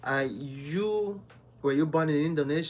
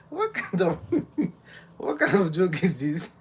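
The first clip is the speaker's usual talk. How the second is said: higher, louder, laughing through the words; real amused laughter; 6 semitones higher, 3 dB louder than usual. Real, not acted. Streamer reacting to a viewer's silly onion joke, laughing. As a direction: protest the joke while cracking up.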